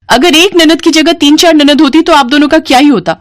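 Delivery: high-pitched, petulant complaining; spoilt sister-in-law. Acted. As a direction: fuss over the food with disgust.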